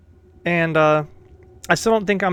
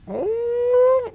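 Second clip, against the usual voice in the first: drawn-out, high-pitched oh; high knowing "oh". Real, not acted. Streamer finding proof they have been together, high knowing oh. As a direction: let the oh soar. Reacts to romance revelation.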